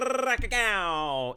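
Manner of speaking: rolled brrr into a loud, high ka-gow; imitated Twin Blast gunfire. Caster recounts Twin Blast coming in, loud imitated gunfire. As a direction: mimic rapid gunfire with the mouth. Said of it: "Twin Blast and go".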